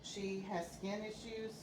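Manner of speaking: ordinary delivery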